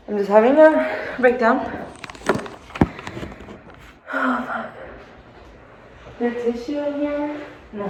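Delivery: high, shaky, on the verge of tears; falling apart in tears; teary breakdown. Real, not acted. Crying breakdown in car.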